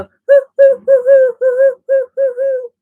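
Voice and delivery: high, loud hammed-up owl hooting; mock owl hoots. Streamer mimicking the witches' owl calls for viewers, loud high mock hooting. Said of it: "this is what they do. They go".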